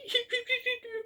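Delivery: squeaky cub giggle; a tiny gleeful laugh; high giggle. Acted. Baby Bear giggling as a squeaky cub. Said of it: "And Baby Bear said".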